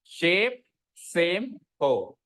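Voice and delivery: शेप सेम हो said very slowly, each word drawn out; slow model pronunciation for the class; slow careful drill. Shown as a demonstration. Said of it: "बोलो क्या?".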